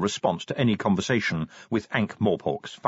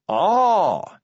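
pompous sergeant voice; 8 semitones higher, 5 dB louder than usual. Acted. Colon voiced in read story.

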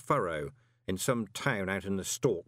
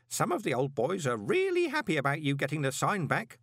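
ordinary delivery, nothing marked

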